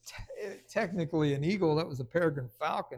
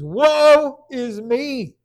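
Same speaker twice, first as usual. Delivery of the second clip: loud, slow drawn-out cry of woe; anguished cry; 5 semitones higher, 11 dB louder, much slower than usual. Retold or imitated. Isaiah standing before God, crying out loud and slow. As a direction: wail it before a holy God. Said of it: "he says".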